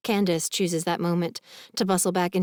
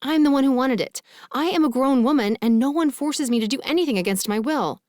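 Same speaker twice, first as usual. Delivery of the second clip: heated protest building louder and higher; rising volume; 7 semitones higher, 5 dB louder than usual. A narrator's reading. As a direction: stand your ground, getting louder as you go. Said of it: "my volume rising".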